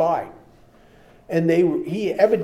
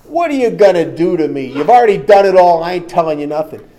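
loud, scornful tough-guy taunt, much louder; cocky macho spy; 3 semitones higher, 11 dB louder than usual. Retold or imitated. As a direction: laugh off the threat like a hardened spy. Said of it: "this macho spy laughs at his face".

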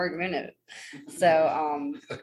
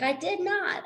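very high mock-mom protest; imitating mom's denial; 13 semitones higher, slower than usual. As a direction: mimic mom denying it in a high voice. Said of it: "And she's like".

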